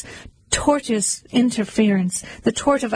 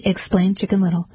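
usual speaking voice

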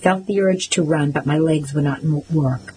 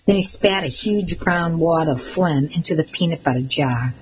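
usual speaking voice

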